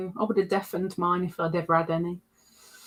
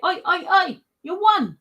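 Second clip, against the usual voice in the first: mock chasing call; 8 semitones higher than usual. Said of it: "chase you down and say".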